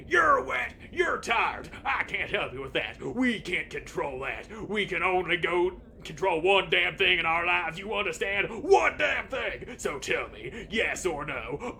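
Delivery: a coach bellowing high and loud into the mower's blades; screaming coach. Acted. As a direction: scream it like a furious pep talk. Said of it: "screams into the blades".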